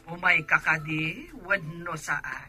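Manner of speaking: faint, distant voice on the line; auntie's voice coming through a telephone, softly; soft voice down a phone line. Acted. Auntie heard over a phone call.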